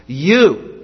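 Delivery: You, said alone and firmly; stress on you. Shown as a demonstration. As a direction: hit the word You hard. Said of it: "for emphasis".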